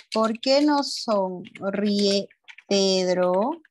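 very slow, question broken before Pedro; slow dictation. Shown as a demonstration. Teacher repeats the question for pupils, very slow dictation. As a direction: stretch each word at writing pace, rising at the end.

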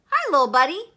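booming, loud hearty greeting; Neptune. A narrator's reading. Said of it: "Neptune boomed".

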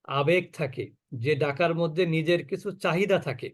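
ordinary delivery

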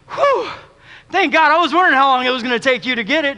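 high, loud relieved exhale from the pastor; mock relief. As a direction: pastor finally relieved he gets it.

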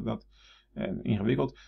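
usual speaking voice

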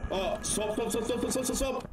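rapid panicked repeating; urgent yelling. Real, not acted. Urgent swap while monster chases.

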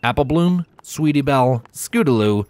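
ordinary delivery, nothing marked

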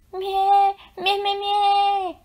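high, slow trembling bleating; frightened bleats. A narrator's reading. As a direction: bleat in panic like tiny lambs. Lambs bleat scared.

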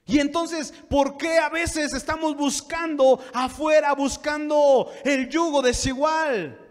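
high, loud pulpit teaching; forceful preaching. Real, not acted. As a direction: drive each point home to the congregation. (prédica enérgica, aguda y fuerte)

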